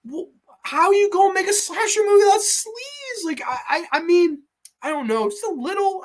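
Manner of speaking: very high, incredulous squeal; exasperated disbelief. Real, not acted. Streamer lamenting slasher movies without sleaze, exasperated disbelief. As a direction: plead in high-pitched exasperation.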